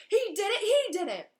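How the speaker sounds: high, loud furious accusation; spat accusation. Acted. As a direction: spit the words out in rage. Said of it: "Filch spat".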